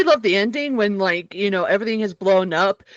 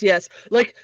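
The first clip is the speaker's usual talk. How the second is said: ordinary talk, nothing marked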